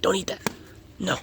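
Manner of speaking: soft whispered warning to the puppy; hushed scolding. Real, not acted. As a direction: tell the dog off under your breath.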